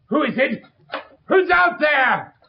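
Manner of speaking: a drawn-out final cry for help; terrified shouting. Acted. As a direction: yell for help in blind panic.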